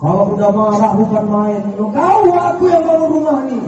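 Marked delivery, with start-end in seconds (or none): 1.8-3.6 higher, indignant snap; snapping husband. Acted. Husband snaps at wife during a quarrel, higher indignant tone. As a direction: throw it back in her face. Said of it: "suami istri bentak-bentakan".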